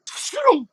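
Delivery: very high airy whoosh; whoosh sound effect. Acted. GM voicing the launch off the slide, high whoosh. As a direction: make the rocket-launch noise.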